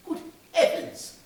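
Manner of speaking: breathy gasp of recognition; awed whisper of surprise; a touch of awed surprise. Acted. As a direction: gasp it, amazed to see the place. Scrooge sees his old workplace.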